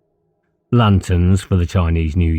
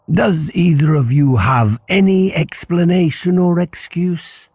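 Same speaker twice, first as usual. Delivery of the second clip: stern headmaster; 11 semitones higher than usual. Acted. Headmaster questions the boys.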